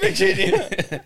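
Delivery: high, drawn-out teasing laugh; mocking mimic. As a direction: stretch the word out and laugh at it. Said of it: "I love it".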